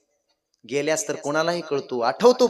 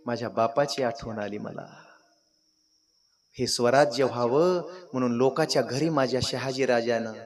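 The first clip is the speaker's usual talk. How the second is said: grieving son; 5 semitones lower, 6 dB quieter than usual. Acted. King recalls his absent father.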